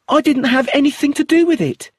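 high, loud desperate denial; panicked denial. Acted. Accused widow denying to the inspector, high frightened plea. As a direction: plead like she's cornered.

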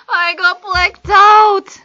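high, loud, slow theatrical wail; dramatic confession. Acted. Teacher confessing to friend in phone skit, dramatic wail. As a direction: cry it out, hammed up and drawn out. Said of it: "제가 그때 얘기를 하는 거죠".

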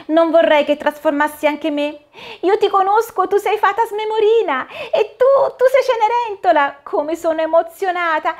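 put-on high, excited little girl voice; a thrilled child meeting Cinderella; little girl Dorina. (voce acuta di bambina emozionata)

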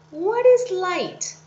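slow, emphatic mock wondering; puzzled question. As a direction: ask it as a puzzled student would. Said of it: "you're asking yourself".

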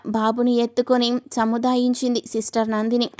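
ordinary delivery, nothing marked

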